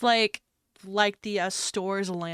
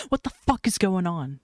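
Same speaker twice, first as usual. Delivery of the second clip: dropped back to natural pitch, firm; exasperated, no more joking; blunt demand; 4 dB louder than usual. Acted. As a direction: drop the act and demand an answer. Banter drops into a blunt demand.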